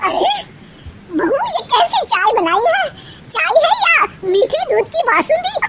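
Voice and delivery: mother-in-law complaining. Acted. Mother-in-law complains about tea.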